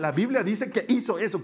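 usual speaking voice

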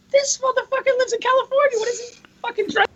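high-pitched mock disbelief; incredulous thought. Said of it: "the whole time I'm thinking".

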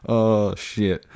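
normal talking voice

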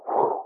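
soft whew of relief; imitated exhale. Boy relaxing under the pastor's hand, imitated sigh. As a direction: let out a long breathy whew. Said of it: "immediately he just".

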